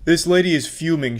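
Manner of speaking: normal talking voice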